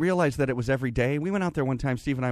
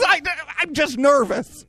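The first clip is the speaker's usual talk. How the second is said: mom's nervous excuse; 9 dB louder than usual. Retold or imitated. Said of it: "She says".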